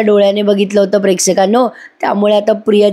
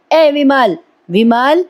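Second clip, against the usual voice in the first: calling out; 6 semitones higher, much slower than usual. A narrator's reading. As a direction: summon the maid from another room. Purnaji calls out for the maid.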